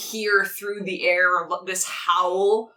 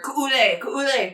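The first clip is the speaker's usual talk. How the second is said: mildly raised battle call; shouting; 5 semitones higher than usual. Acted. Game master voicing soldiers shouting into the air, mild shout. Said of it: "shouting into the air".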